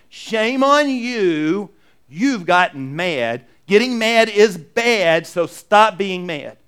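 slow, loud mock rebuke; stern scolding. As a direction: scold them word by drawn-out word. Voices God as a scold.

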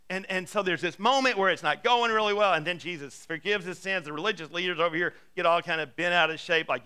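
ordinary delivery

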